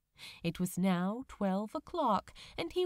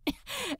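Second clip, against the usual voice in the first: laugh; 14 semitones higher than usual. A narrator's reading. Crowley laughing.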